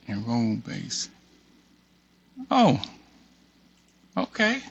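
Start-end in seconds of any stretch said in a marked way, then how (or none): 2.5-4.6 high rising okay of surprise; oh, it actually works; surprised okay. Real, not acted. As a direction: react as if it suddenly worked.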